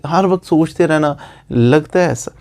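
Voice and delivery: usual speaking voice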